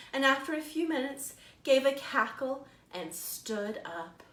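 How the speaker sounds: slow, lively storytime narration; animated picture-book storytelling; reading to children. A narrator's reading. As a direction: read it like a librarian to little kids. Librarian reads picture book aloud.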